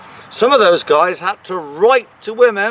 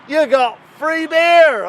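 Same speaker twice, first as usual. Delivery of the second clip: excited shout; 7 semitones higher than usual. Acted. Punchline about voting for brewer.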